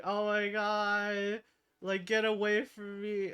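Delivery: mock scream, fending something off; retold screaming. Retelling coworker screaming at a feral cat, imitated scream. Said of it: "she's screaming".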